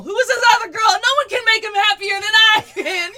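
high, loud hammed-up jealousy; mock jealous rant. Admitting to her friend she was jealous, mock ranting.

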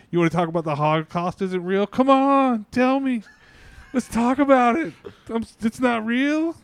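high, loud, gleeful egging-on; mock eager host. Retelling a podcaster welcoming any wild guest, mock eager. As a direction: excitedly goad them on, voice leaping up.

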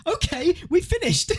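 high squeaky laugh through the words; laughing too hard to finish. Real, not acted. Teasing girlfriend's threats, cracks up laughing.

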